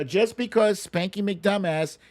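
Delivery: ordinary delivery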